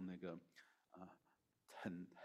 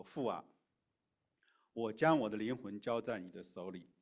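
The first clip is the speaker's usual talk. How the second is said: raised voice, read-aloud cry; loud cry; 8 dB louder than usual. A narrator's reading. Jesus calls to the Father at his last breath, loud declaimed cry. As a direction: proclaim it loudly, as scripture. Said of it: "耶稣大声喊着说".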